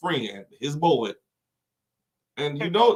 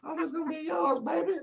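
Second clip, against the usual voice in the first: high, soft sweet-talking voice, softer; coaxing crackhead; 13 semitones higher, 6 dB quieter than usual. Acted. Crackhead to the slave owner's son, high wheedling sweet talk. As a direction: sweet-talk the rich kid into it.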